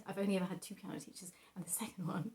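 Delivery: slipped into an unvoiced whisper. Real, not acted. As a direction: whisper it as an aside. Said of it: "oops, sorry".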